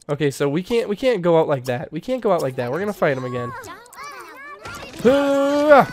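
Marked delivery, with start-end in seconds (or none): 5.0-5.9 high whooping war cry; rally yourself with a whoop; short shouted hoo-ah; battle cry. Real, not acted. Battle cry before fight.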